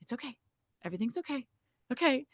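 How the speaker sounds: soft, hushed it's okay; calm her down gently; soothing reassurance. Retold or imitated. Family hushing the labouring mother, soft and soothing. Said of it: "Everyone just goes".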